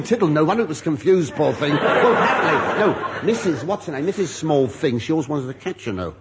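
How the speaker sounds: ordinary talk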